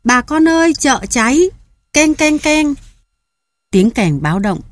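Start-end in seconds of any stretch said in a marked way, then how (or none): 0.0-2.7 frantic fire alarm cry; alarm shout. Acted. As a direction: yell for everyone, clanging the bell. Raising alarm about market fire.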